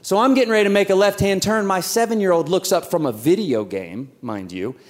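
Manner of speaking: ordinary talk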